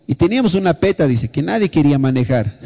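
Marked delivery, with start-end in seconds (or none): none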